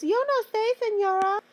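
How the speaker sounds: very high-pitched mock student voice; student imitation. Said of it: "Every student's favorite phrase".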